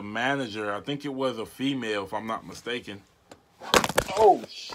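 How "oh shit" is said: sudden high yelp; surprised. Real, not acted. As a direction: blurt it out, caught off guard. Startled outburst on call.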